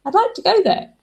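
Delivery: hinting partner asks sweetly; hinting request. Retold or imitated. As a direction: coax for a dinner date. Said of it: "kind of meaning".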